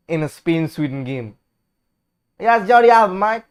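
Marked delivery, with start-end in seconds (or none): 2.4-3.4 put-on a light, loose Australian accent; a quick Aussie "mate" call; mild Australian commentator voice. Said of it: "No, that's Australian".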